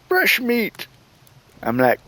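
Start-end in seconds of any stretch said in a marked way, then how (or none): 0.1-0.9 very high-pitched haunt actor call; mock menacing cry. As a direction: imitate a monster pouncing on visitors. Imitating haunt actor jumping out.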